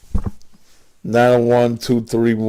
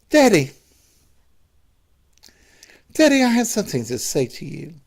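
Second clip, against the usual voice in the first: higher, hesitant son calling his daddy; a son in a higher voice his father dislikes, starting a confession; son's put-on voice; 5 semitones higher, much faster than usual. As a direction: play the son nervously working up to it. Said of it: "using that voice that he don't like".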